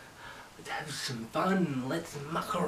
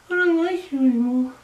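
higher and louder sulky character voice; Goggles voice; 11 semitones higher, 12 dB louder, faster than usual. Acted. Goggles sulks at the host after being threatened, high and loud. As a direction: pout it out in the high put-on voice. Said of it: "I'm Goggles".

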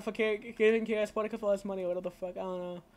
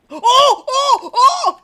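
three high, very loud cries; startled whoops; 16 dB louder, much slower than usual. Real, not acted. Player in a sudden scare, high loud whoops. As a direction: cry out in quick bursts. Near miss in game.

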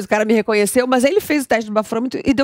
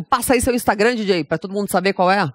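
ordinary talk, nothing marked

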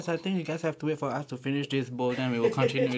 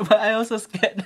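nervous laughing; 6 semitones higher, 10 dB louder than usual. Real, not acted.

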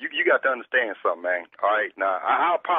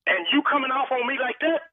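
much higher, heated pushback; rising anger; 12 semitones higher than usual. Real, not acted. Repairman snapping back at the caller over his wife. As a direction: defend yourself, getting worked up.

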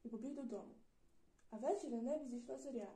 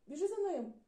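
loud, higher call; 6 semitones higher, 8 dB louder than usual. Acted. Mother calling to the boy to chase the dog, loud. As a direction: send the boy running. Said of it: "гукнула мама".

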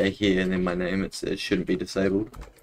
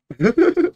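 burst of laughter; 8 dB louder than usual. Real, not acted. Reacts to a player's odd move.

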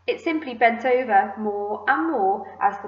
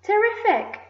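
high, gleeful exclamation; excited cry; 12 semitones higher, 4 dB louder than usual. A narrator's reading. Aunt thrilled by the peach, crying out. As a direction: cry out in delight. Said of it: "Aunt Sponge cried out".

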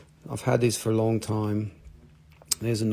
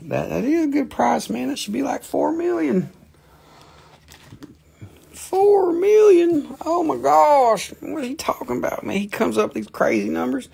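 outraged disbelief; 14 semitones higher than usual. Acted. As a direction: squeal in mock outrage. High outraged reply to puppet.

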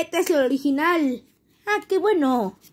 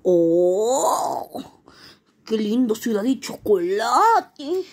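character voice; 6 semitones lower, slower than usual. Acted. Character marvels at chocolate city.

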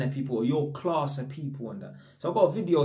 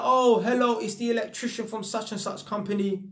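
higher-pitched imitation of himself on a work call; polite phone introduction; 6 semitones higher than usual. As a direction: put on a polite, high phone hello. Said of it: "I'll phone up the tenants, I'm like".

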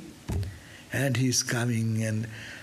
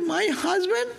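incredulous wife; 7 semitones higher than usual. Retold or imitated. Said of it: "She said".

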